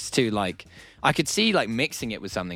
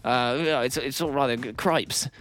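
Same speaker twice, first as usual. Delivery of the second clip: posh British prime minister; 3 semitones higher than usual. Pretend. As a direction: play a fussy posh British politician, 'jolly good' and 'cripes'. Mocking the new prime minister.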